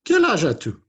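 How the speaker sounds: high, loud puppet question; high-pitched character voice; Inez puppet voice. Acted. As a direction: pipe up as a little puppet quizzing the class. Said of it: "She's gonna ask you how old you are".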